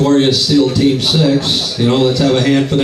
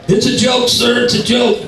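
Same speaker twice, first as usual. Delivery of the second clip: high, nervous pleading; panicked pleading; 10 semitones higher than usual. Retold or imitated. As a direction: squeak it out, caught and scared. Panicked friend pleading to boss.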